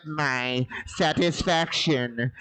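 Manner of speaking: loud, slow robotic synthesized speech voice; Stephen Hawking. Retold or imitated. Comic mimicking a dildo voice as a speech synthesizer, loud and slow. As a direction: speak like a flat computer voice, word by word. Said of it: "That's also my Stephen Hawking's impression".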